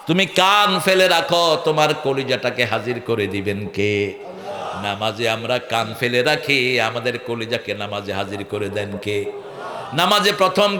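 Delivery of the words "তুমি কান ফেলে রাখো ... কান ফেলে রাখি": usual speaking voice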